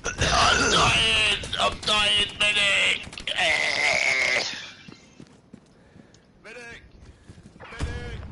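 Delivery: high pleading cry for a medic; man down shout. Acted. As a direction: yell for help while downed. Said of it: "man down in game".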